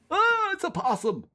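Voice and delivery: high-pitched yelp of surprise; startled yelp. Acted. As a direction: blurt it out in shock. Startled by possum in drawer.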